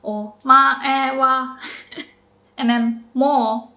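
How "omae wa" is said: Omae wa said slowly, only o low; slow pitch demo. Shown as a demonstration. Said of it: "only o is low".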